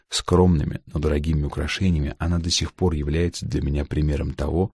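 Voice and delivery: ordinary delivery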